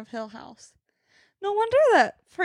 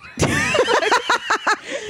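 high-pitched burst of laughter; real laughter; 10 semitones higher than usual. Real, not acted. Roommate laughing at her own joke, high laughter.

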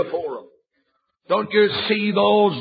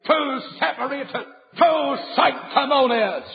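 pulpit-pounding revival preaching; preaching at full force; 8 semitones higher than usual. Acted. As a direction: thunder it at the young sinner. Denouncing religious hypocrites.

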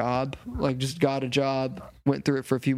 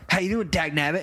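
higher-pitched imitation of a coworker; perky mimicked greeting; coworker's catchphrase voice; 4 semitones higher than usual. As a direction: imitate a man with a folksy catchphrase. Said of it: "And that's how he talks".

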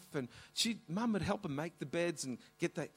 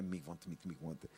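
much lower, much softer murmured rehearsal; rehearsing under breath; 10 semitones lower, 11 dB quieter, faster than usual. Retold or imitated. Mum waiting in the butcher shop, rehearsing in a low murmur. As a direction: murmur the line to yourself while waiting. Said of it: "Mum's waiting there".

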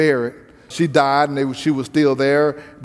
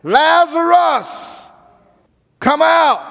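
high, long drawn-out call of Lazarus; loud call; 11 semitones higher, 3 dB louder, much slower than usual. Acted. Jesus calling Lazarus from the tomb, long ringing call. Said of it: "Jesus called out in a loud voice".

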